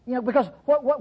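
ordinary delivery

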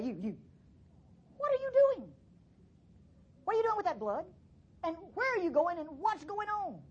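high, pressing questions to the priest; urgent questioning. Acted. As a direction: demand answers, voice climbing high. Acted confrontation with high priest.

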